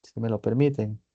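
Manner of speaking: normal talking voice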